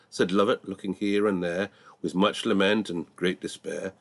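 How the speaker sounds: plain reading voice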